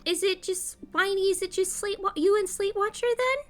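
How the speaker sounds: high curious kitty pet. Acted. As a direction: sniff out the hidden cat. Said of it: "she's like".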